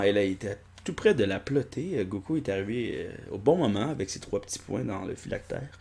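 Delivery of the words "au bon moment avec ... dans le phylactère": ordinary delivery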